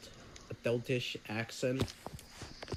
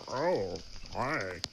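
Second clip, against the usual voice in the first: lightly voiced cartoon character impression; a hint of a game character, swooping tune on each word; Baldi; 4 semitones lower, 3 dB quieter than usual. Streamer voicing a plushie's greeting, light impression. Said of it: "my Baldi impression".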